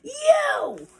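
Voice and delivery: very high, loud, stretched shout; triumphant cry. Acted. Hen throwing the fox in the pot, high loud cry. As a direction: pounce on the word at high pitch.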